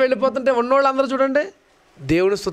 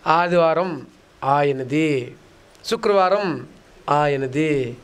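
slow mock; 6 semitones lower, much slower than usual. Mocking churchgoers' attitude.

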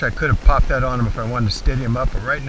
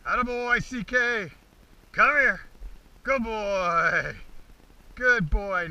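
calling the dog; 7 semitones higher, much slower than usual. Real, not acted. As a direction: call the dog in cheerfully. Calling dog back to him.

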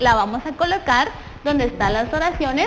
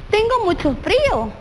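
exclaimed shivering complaint; 7 semitones higher than usual. Shown as a demonstration.

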